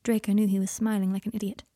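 plain reading voice

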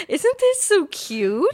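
high, drawn-out gushing imitation; slow, high-pitched mock delight; tickled mom. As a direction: imitate a mother cooing over something adorable. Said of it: "My mom was so tickled at that ad".

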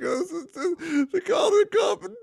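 high, wailing mock sob, slow; sobbing like a baby. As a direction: blubber the words out like a crying baby. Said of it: "I'm sobbing like a baby".

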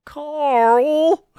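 very high, loud, long-held cry of the name; drawn-out shrieky mock outrage; cartoon scolding yell. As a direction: yell it like an appalled cartoon character. Echoing the cartoon catchphrase loudly.

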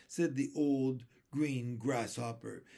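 plain reading voice

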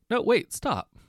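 high loud protest; protesting cry. A narrator's reading. Woman trying to stop the elf taking the bags, loud. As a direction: call out to stop him. Nancy protests, too late.

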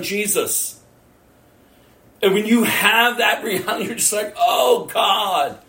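high, forceful sermon delivery; impassioned preaching. Real, not acted. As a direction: preach it out, pitched well up. Preaches with raised voice.

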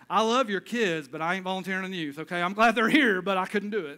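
playful loud refusal to volunteer; emphatic joking. Real, not acted. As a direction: lean in hard on the punchline.

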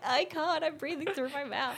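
high and loud, words breaking into laughter; laughing through it. Retold or imitated. Recalling being unable to breathe while face down, laughing it out high and loud. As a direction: laugh your way through the complaint.

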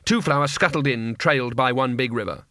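ordinary delivery, nothing marked

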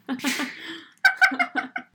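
sudden high laugh; high burst of laughter. Real, not acted. Laughs after review.

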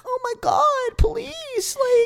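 high pleading voice. Retold or imitated. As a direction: whine it high like a begging girl. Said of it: "she's like".

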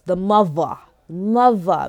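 "mother" said with a v in place of th, as "muvva"; slangy "muvva". Shown as a demonstration. Podcaster naming a church's first lady, "mother" pronounced slangy "muvva". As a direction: say "mother" as "muvva", drawing out the word. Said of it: "me, M U V A".

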